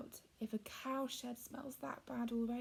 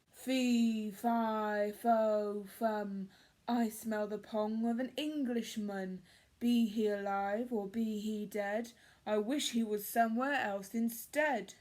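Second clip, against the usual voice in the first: loud, booming giant chant; roaring giant; 5 dB louder than usual. Acted. Giant sniffing out the boy, loud roar. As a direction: boom it out like a hungry giant. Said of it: "roared the giant".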